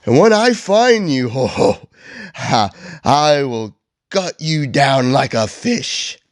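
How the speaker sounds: indignant, hammed-up Grinch; theatrical affronted villain, not truly shouting; offended mock yell. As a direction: declaim the outrage with swooping pitch. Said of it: "yells back".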